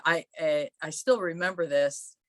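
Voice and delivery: ordinary talk, nothing marked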